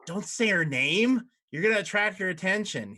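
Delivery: a touch of jittery fear; nervous warning. Acted. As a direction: warn him in a frightened rush. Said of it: "he goes".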